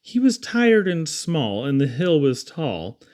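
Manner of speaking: ordinary delivery, nothing marked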